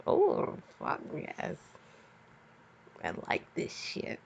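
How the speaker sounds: breathless whispered delight; whispering while reading smut aloud. Real, not acted. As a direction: hush it like a guilty secret. Reacting to spicy fanfic line.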